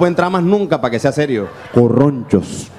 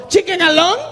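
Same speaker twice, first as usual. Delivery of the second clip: echoing question; 13 semitones higher than usual. Retold or imitated. Customer echoes waiter.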